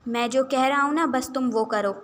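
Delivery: loud, insistent order; angry command. Acted. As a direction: shut down her argument angrily. Angry brother orders his sister. (غصے سے حکم دیتے ہوئے)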